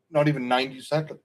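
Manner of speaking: talking through hiccups; hiccuping mid-sentence. Real, not acted. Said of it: "I get the hiccups".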